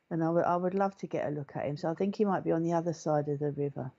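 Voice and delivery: usual speaking voice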